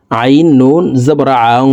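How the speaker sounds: slow spelling ending in a long nasal hum on "আং"; nasal ghunnah. Shown as a demonstration. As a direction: hide the n of "আং" in the nose and hold it. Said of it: "নাকের ভিতরে লুকাইয়া গুন্নার সহিত পড়ব". ("আং"-এ নাকের ভিতরে গুন্নাহ করে টানা)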